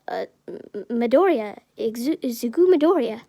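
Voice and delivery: halting start, then blurted name; nervous stammer. Acted. Izuku giving Aizawa his name, nervous stammering. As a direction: stumble on the first sounds, then blurt it.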